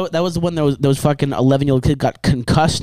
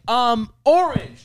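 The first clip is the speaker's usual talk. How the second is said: put-on dumb kid voice; 10 semitones higher, much slower than usual. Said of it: "these kids were like".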